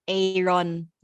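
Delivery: AARON as Ay-ron, Filipino style; Filipino pronunciation. Shown as a demonstration. Correcting a friend on the Filipino version, Ay-ron for AARON. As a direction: say AARON with a long "ay" first. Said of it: "The Filipino version is".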